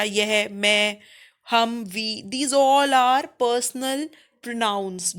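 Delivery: drawn out very slowly; slow dictation. Shown as a demonstration. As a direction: dictate each word slowly. Teacher dictating summary slowly. (बहुत धीरे लिखवाना)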